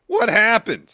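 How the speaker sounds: high, loud hammed-up bewilderment; mock shocked shout. Co-host imitating a wrestler who just hid, mock shout. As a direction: yell it in exaggerated fake surprise. Acting out wrestler feigning ignorance.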